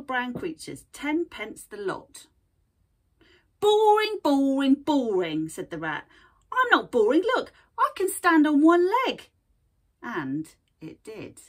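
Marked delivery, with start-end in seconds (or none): 3.6-5.5 "Boring" falling each time, loud rat drone; bored rat voice. Acted. Rat dismisses creatures. 6.5-9.3 high, loud, proud protest; indignant little creature. Acted. Creature answers rat.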